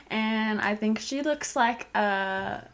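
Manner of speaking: usual speaking voice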